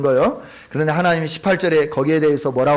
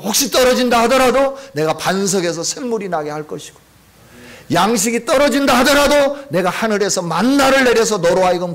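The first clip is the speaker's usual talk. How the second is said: full-force preaching; 5 semitones higher than usual. Acted. Pastor proclaiming God's promise to the congregation, fervent preaching.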